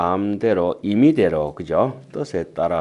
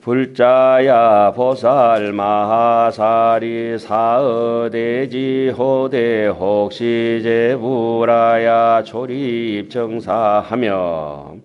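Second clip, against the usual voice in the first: 느리고 크게 읊는 경전 독송; 천천히 운율을 살려 경문을 읊듯이; 3 semitones higher, 4 dB louder, much slower than usual. Acted. Reads sutra text aloud. (법문 전에 대중에게 경문을 낭송하듯)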